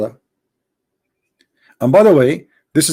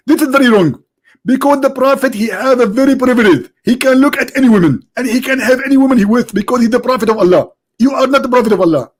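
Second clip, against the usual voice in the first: much higher, indignant preacher voice; Zakir Naik impression; 6 semitones higher than usual. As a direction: play the annoyed preacher being called. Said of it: "Doctor Zakir Naik".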